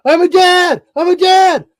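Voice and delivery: very high, full-volume scream; joyful yelling. Retold or imitated. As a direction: scream it at the top of your lungs. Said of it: "I start yelling".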